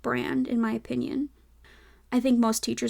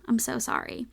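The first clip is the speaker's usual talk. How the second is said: ordinary delivery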